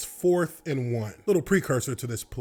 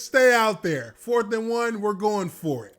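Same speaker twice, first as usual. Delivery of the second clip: coach's order, louder and higher; mock coach call; 6 semitones higher, 4 dB louder than usual. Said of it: "He says".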